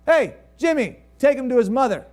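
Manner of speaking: loud, high call across the field. Retold or imitated. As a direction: call out to the servant. Father calls to servant.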